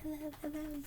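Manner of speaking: soft, lightly voiced star greeting; a breezy little wave to fans; quiet, lightly put-on celebrity hello; rock star Fluffy. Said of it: "He's like".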